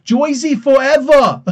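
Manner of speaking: loud, high, drawn-out Brooklyn shout; exaggerated Brooklyn accent. Shown as a demonstration. Joking about how Jersey should be said, exaggerated Brooklyn accent. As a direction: belt it out in hammed-up Brooklynese, "Joisey fo-evva". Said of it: "You gotta do it in the Brooklyn".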